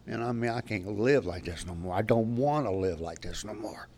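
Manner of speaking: a drinker begging his counselor on the phone for help. Retold or imitated. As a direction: beg quietly for help, worn out. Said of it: "I begged her".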